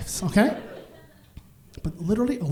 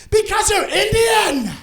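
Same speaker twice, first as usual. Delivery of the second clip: high, loud, slowed declaration; emphatic shout; 12 semitones higher, 5 dB louder than usual. Retold or imitated. HR manager answering the comic, emphatic shout. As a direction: say it with your whole chest. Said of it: "With her whole chest".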